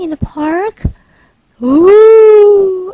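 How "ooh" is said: excited ooh. Acted. Excited exclamation.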